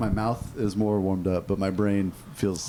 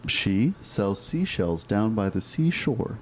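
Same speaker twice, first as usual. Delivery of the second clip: slow careful tongue twister; slower than usual. Shown as a demonstration. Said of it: "tongue twister said slowly".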